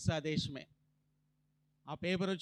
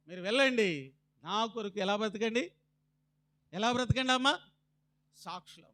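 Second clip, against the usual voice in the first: normal talking voice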